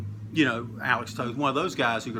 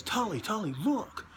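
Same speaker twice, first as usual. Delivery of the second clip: higher, softer urgent calling; 6 semitones higher, 6 dB quieter than usual. Retold or imitated. Manager nudging his wrestler to look, urgent and higher. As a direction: hiss his name to get his attention. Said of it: "he runs in the ring and goes".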